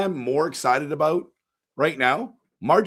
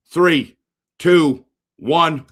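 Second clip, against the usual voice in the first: loud, high countdown building suspense; excited countdown; 3 semitones higher, 8 dB louder, much slower than usual. Real, not acted. Streamer counting down the draft lottery to his chat, loud and excited. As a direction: call out the countdown.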